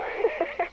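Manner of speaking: a quick high giggle; giddy laugh. Acted. Shop owner bragging about the statue sales, giddy giggle. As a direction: let a short excited laugh slip out.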